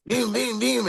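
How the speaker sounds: high-pitched toy motor noise; little toy engine going in short bursts; imitated toy chainsaw. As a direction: mimic a tiny chainsaw buzzing to life. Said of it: "it makes a little sound".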